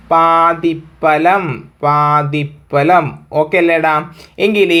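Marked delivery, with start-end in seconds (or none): 0.1-3.0 പാതിഫലം stretched out very slowly, twice; teacher dictating to students; slow drill. Shown as a demonstration. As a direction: drag out പാതിഫലം so the class can write it. Teacher drills the joined word.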